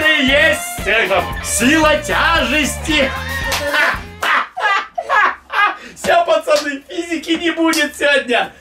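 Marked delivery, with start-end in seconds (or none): 3.1-5.7 high gleeful laughing fit; hearty laugh. Real, not acted. Пранк удался. (заливистый смех)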